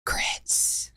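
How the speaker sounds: playful conspiratorial whisper; hushed, voiceless aside. Pretend. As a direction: whisper it like the secret ingredient. Whispered grits passed between the hosts.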